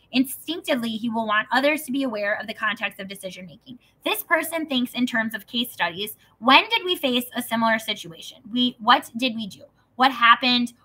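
a hint of a reading voice; reading aloud. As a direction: read it off the page. Reads book passage aloud.

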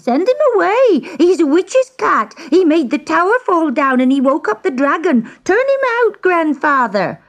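high frantic scream; screaming girl. A narrator's reading. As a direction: keep screaming at grandfather. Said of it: "she screamed".

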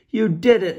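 high, loud joyful cry; excited cheer. A narrator's reading. Lucas and Clara cheering Petros's win. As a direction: cheer the victory. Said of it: "Lucas and Clara cried".

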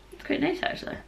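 soft voiceless whisper, voice gone; whispering from lost voice. Real, not acted. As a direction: whisper it, you can barely speak. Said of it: "Apologies for the voice".

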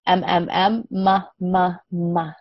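put-on slow, drawn-out letter sounds for a child; sounding out M. Shown as a demonstration. Teacher modelling the M sound for a young learner. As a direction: hum each M, then open to ma.

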